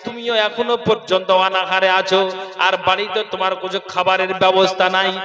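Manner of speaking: usual speaking voice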